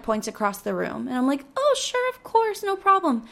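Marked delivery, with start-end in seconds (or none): 1.6-3.2 chirpy helpful. Retold or imitated. Her eager shop assistant reply.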